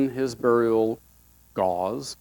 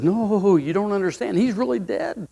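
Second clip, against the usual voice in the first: insistent grieving woman; 6 semitones higher than usual. Acted. Martha insists to Jesus.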